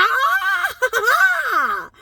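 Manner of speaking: high, loud joyful whoop of laughter; excited shrieking laugh. Real, not acted. Wrapping up her bookstore story, joyful shrieking laugh. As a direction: let out a delighted shriek.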